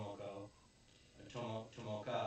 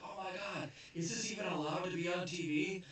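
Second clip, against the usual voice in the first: mock shocked gawk; 8 semitones higher than usual. Boys gawking at commercial.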